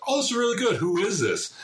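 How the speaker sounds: high, delighted mock listener; mock enjoyment. Comedian in a sketch enjoys a song, mimicked high. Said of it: "He's like".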